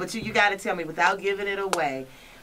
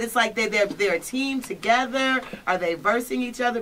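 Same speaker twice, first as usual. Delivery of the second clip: ordinary talk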